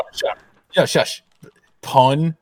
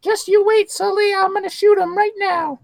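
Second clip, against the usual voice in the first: much higher, cartoon-squeaky voice; 3 dB louder, slower than usual. Threatening to shoot a rival, in a much higher squeaky voice. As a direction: squeak it like a tiny cartoon critter.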